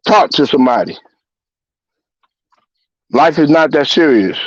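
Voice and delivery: ordinary talk, nothing marked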